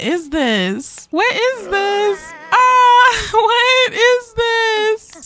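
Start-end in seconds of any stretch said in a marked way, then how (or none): 1.1-2.3 much higher, drawn-out squeal; shocked squeal. Real, not acted. Player freaking out at Nathaniel's transformation, high drawn-out squeal. 2.5-5.2 high, loud, drawn-out wail; shocked shriek. Real, not acted. Player screaming over Nathaniel's new look, high wailing shriek.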